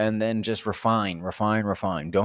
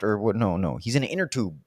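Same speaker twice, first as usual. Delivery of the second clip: ordinary delivery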